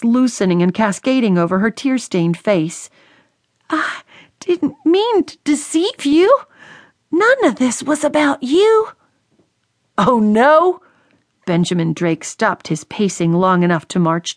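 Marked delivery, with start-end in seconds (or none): 3.7-8.9 put-on high, pleading young voice; the daughter insisting it isn't about him; tearful daughter. A narrator's reading. Tearful daughter defends herself. 10.0-10.8 mocking, raised oh no; the angry father doubting his daughter; sarcastic retort. A narrator's reading.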